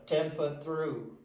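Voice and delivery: ordinary delivery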